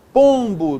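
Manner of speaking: "Pombo" loud, "Pom" lengthened; stressed nasal syllable. Shown as a demonstration. Teacher showing a nasal tonic, "Pombo" loud with strong first syllable. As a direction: lean hard on the "Pom" of "Pombo". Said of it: "tônica nasal".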